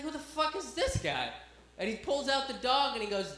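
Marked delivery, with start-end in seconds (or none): 0.0-1.3 high-pitched comic bewilderment; baffled disbelief. Retold or imitated. Comic reacts to the dog handler in cargo shorts, high baffled disbelief. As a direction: pitch it up, thrown by what you see.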